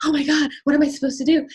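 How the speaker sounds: flustered rush; mock panic; panicked. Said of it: "I'm like".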